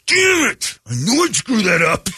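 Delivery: hammed-up caught-out groan; frustrated outburst. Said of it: "And he goes".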